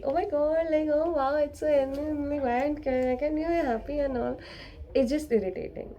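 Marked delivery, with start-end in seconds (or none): 0.0-4.4 higher, gushing mock cheer; toxic positivity. Overly positive friend gushing at someone depressed, mock cheer. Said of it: "to the point of toxic positivity".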